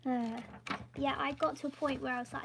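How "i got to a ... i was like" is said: ordinary talk, nothing marked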